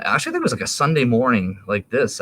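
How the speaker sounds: ordinary talk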